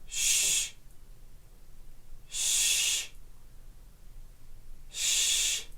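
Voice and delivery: "Sh-" as a soft hissed shh, no voice; sh sound. Shown as a demonstration. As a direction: hush out "Sh-" alone. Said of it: "Let's give it a try".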